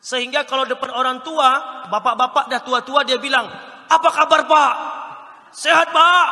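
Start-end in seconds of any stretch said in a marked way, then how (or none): 3.8-6.2 loud, high mock-energetic greeting; youth-speaker hype. Trainer mimicking speakers greeting elderly men with the wrong intonation, loud hyped-up call. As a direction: hail them like a hyped-up youth presenter. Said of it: "orang tua itu mesti kalem-kalem".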